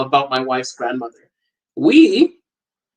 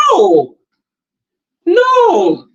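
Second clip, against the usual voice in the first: exasperated no; 13 semitones higher, 5 dB louder than usual. Retold or imitated. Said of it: "she was like".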